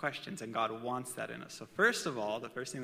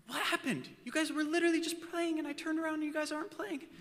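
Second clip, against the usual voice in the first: put-on high, puzzled dad voice asking kids; baffled, pitched way up; mock-bewildered what happened; confused parent.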